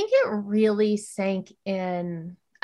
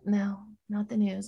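patient correction; 8 dB quieter than usual. Retold or imitated. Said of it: "And I'm like".

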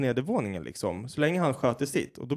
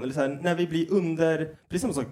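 usual speaking voice

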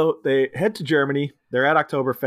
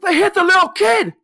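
very high, loud mock scream; quoted outraged yell; 7 dB louder than usual. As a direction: shriek it in hammed-up horror. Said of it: "another line I love randomly quoting".

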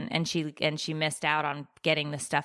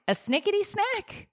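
mock exasperation. Real, not acted. Mocking kid's missing snack.

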